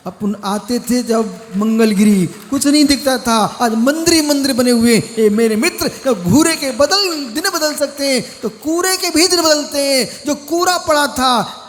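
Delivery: loud, high, rousing sermon; full-force preaching. Real, not acted. Monk telling followers that fortunes can change, full-force preaching. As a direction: build to a forceful proclamation for the congregation.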